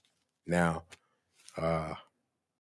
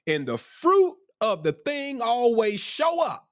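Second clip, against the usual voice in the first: high, drawn-out preacher's delivery; impassioned preaching; 10 semitones higher, slower than usual. Real, not acted. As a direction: exhort them, stretching the words high. Preacher exhorts at full pitch.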